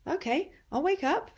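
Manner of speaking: sharp puff, then high-pitched perky reply; body waking up. Pretend. Breathwork coach voices the body's response to forced breathing, high-pitched waking up. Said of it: "the body goes".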